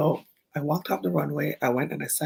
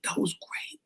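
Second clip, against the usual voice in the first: whispered; 5 semitones higher, 7 dB quieter, slower than usual. Retold or imitated. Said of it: "he was like".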